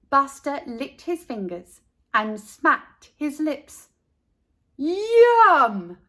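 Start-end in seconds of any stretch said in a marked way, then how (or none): 4.8-6.0 high, loud happy "Yum!"; delighted. A narrator's reading. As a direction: savour it out loud. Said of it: "he said".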